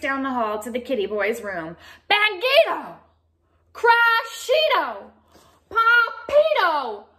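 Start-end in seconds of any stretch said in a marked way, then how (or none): 2.1-7.0 high, loud, drawn-out shouts; loud calls. Acted. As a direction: call out each name. Boy shouting in closet.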